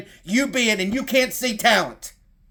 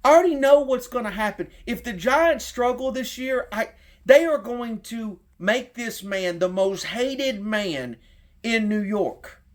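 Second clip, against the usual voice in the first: ordinary delivery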